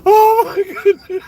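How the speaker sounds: high, wheezing laughter breaking through the words; laughing so hard he can't breathe; crying with laughter. Real, not acted. Vlogger cracking up at the hospital photo, crying with laughter. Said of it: "I got tears coming out my".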